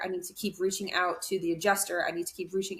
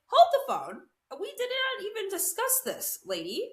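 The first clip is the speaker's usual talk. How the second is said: shocked protest; 13 semitones higher than usual. Retold or imitated. Retelling her shocked reply to lady.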